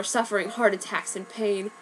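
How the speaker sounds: ordinary delivery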